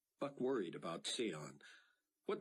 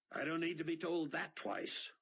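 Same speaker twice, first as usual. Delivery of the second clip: higher, eager pilot's quip; Mac's voice; 10 semitones higher than usual. A narrator's reading. Pilot answering his captain, wry eagerness.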